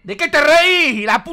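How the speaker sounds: outraged what are you laughing at; indignant yell. Real, not acted. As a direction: snap at the laughing doll. Yelling at laughing monster.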